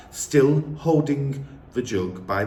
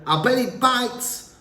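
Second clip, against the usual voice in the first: put-on child voice; 13 semitones higher than usual. A narrator's reading. Lavender voiced.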